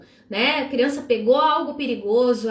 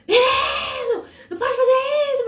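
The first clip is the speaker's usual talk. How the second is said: much higher, loud scolding cry; scolding yell; 13 semitones higher, 4 dB louder than usual. Retold or imitated. Mother scolding child after a fright, loud yell. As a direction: scold the boy after the scare, half laughing. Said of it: "esse grito aí já não pode".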